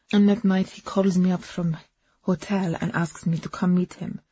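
ordinary delivery

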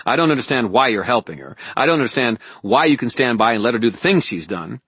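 loud, fast, angry outpouring; heated tirade, building at the end; snapped. A narrator's reading. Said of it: "I snapped".

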